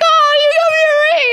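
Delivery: high, fast, laughing squeal. Retold or imitated. Retold reaction, laughing at gift.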